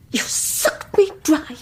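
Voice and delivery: bitter words breaking into a cry; tearful. Acted. Bitter confession to Mrs Faulkner.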